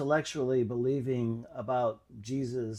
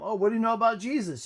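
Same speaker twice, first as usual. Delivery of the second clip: higher, fast, pressing question; quick challenging girl; 8 semitones higher, 6 dB louder, faster than usual. Retold or imitated. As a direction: fire it back at him. Girl challenges his knowledge.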